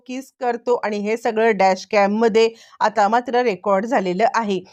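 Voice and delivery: ordinary delivery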